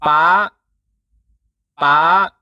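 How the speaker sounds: PA hit hard, climbing high. Shown as a demonstration. Teacher showing the high tone, hit harder and held. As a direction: hit "pa" harder and hold it as it rises. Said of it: "but you hit the sound harder".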